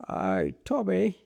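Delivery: put-on high, drawn-out English-accent call. Rich man hailing his pilot, mock English accent. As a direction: put on a posh-boss English accent greeting. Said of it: "start having English accent".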